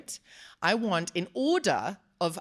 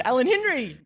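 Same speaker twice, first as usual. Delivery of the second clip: excited call; 7 semitones higher, 6 dB louder than usual. Acted. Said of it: "Can you tell we're a little bit excited?".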